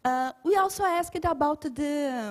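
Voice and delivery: usual speaking voice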